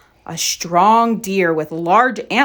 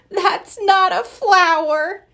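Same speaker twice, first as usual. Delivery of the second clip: giggling; 10 semitones higher, 3 dB louder, slower than usual. Acted. Thumper giggles at Bambi.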